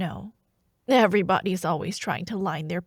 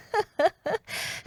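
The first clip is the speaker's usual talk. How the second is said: high amused laugh; 10 semitones higher than usual. Acted.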